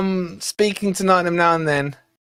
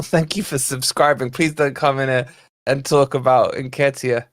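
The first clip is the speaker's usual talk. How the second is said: usual speaking voice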